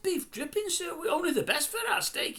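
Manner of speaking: high, put-on chef voice, all politeness; proud chef. Chef answering Hector's complaint, high hammed-up voice. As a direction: play the chef boasting about beef dripping. Voicing the chef in retold scene.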